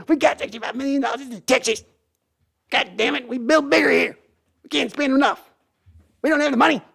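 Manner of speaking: very high mock blustering old owner; Jerry Jones impression. Jerry boasts to his son about money, very high mock bluster. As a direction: bluster about Texas building bigger. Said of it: "Jerry and Sons impression".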